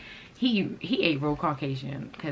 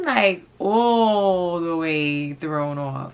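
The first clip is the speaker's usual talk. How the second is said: "all" stretched very long and loud; drawn-out emphasis; 5 dB louder, much slower than usual. Real, not acted. Telling viewers how thrown off she was, drawn-out emphasis. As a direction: drag out "all the way" in disbelief.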